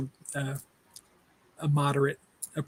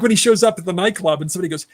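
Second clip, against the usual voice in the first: usual speaking voice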